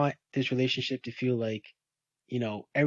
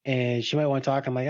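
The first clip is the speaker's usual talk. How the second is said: ordinary talk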